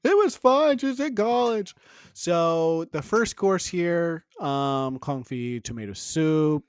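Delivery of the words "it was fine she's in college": squeaky high cartoonish Seinfeld; high mock Seinfeld voice. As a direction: ham up a high-pitched celebrity voice.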